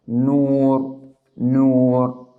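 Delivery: "nur" drawn out slowly with rounded lips; rounded damma. Shown as a demonstration. Teacher showing nun with damma, "nur" long and rounded. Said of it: "you must round your lips".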